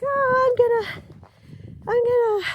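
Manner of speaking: high and loud, eager; high excited quote. Retold or imitated. Retelling her morning to viewers, high and loud eager mock quote. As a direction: squeal it out like you are all excited. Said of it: "I was just, you know, like".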